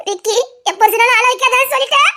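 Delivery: ordinary talk, nothing marked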